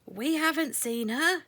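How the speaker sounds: called out. A narrator's reading. Mice in the hawthorn call back, high and loud. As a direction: call down from the trees. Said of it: "called the mice".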